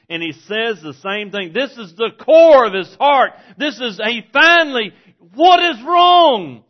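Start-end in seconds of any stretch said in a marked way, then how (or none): 1.5-4.9 much louder and higher, near a shout; shouting preacher. Acted. Preacher reaching the core of the story, shouting. Building to climax of sermon. 5.3-6.6 "What is wrong?" shouted high, loud and slow; screamed question. Shown as a demonstration. Preacher showing the wrong way to ask, loud slow scream. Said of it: "But he didn't scream it".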